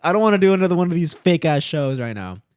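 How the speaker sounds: high, loud fed-up complaint; refusing the camera crew, loud and irritated; annoyed outburst. Retold or imitated. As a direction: push back hard on the camera crew. Reluctant at another interview.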